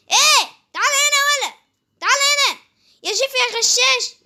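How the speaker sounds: high, loud call after a cheater; mock outraged yell. Yelling at cheating Jiffy to come back, mock outrage. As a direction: shout him back, playful indignation.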